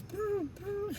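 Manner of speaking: high, soft mock pigeon cooing; pigeon coo imitation. Imitating pigeons in tarot story.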